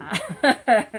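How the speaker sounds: a sudden loud real laugh; burst of laughter. Real, not acted. Vlogger laughing after dropping cream on camera, loud real laugh. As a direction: crack up at your own clumsiness. Drops cream mid-review.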